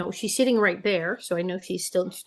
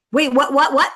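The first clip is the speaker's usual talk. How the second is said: mock disbelief; 6 semitones higher, 8 dB louder than usual. Party guys react to Casper news.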